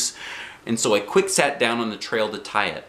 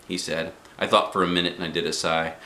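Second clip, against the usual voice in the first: ordinary delivery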